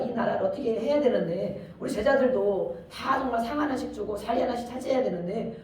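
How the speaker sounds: normal talking voice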